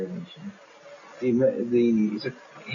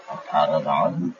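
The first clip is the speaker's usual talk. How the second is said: puzzled chief; 8 semitones lower than usual. Acted. Chief answers in his own voice.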